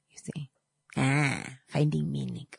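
hushed whispered aside. Real, not acted. Teacher whispering a knowing aside to the class. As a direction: lean in and whisper it. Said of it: "whispered aside in lesson".

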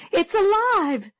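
high, slow, shrieking cry of horror; terrified scream. A narrator's reading. Teacher screaming at a crawling cootie in fright. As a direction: shriek it out in panic. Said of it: "she screamed".

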